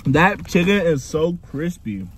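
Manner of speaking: eating and talking; talking while chewing. Real, not acted. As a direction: mouth partly full. Seen 0: hand to mouth before; lips work as if chewing during words.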